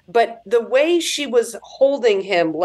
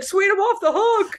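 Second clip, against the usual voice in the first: mock relief; 9 semitones higher than usual. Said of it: "and she's like".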